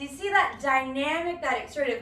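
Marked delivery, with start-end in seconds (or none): none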